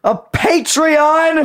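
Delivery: drawn-out high squeal; squealed high and slow. Pretend. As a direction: stretch it into a shriek. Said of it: "A what?".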